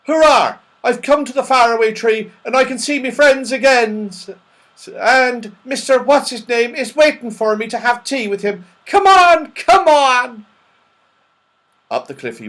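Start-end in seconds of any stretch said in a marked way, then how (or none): 0.1-10.5 loud, high, jubilant cries; shouting with delight. Acted. Saucepan man off to see his friend, shouting with delight. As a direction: cheer and yell for joy. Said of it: "shouting with delight".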